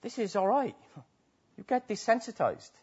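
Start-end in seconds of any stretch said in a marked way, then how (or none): 0.0-0.8 casual inner voice. Retold or imitated. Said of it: "and we start to think".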